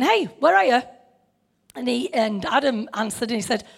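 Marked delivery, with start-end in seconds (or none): 0.0-0.8 friendly, offhand hey; casual call. Shown as a demonstration. Preacher shows God calling casually, friendly. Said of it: "He might have been saying".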